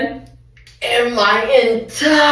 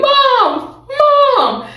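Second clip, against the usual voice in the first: mock terrified scream. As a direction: imitate a scared kid yelling for mom.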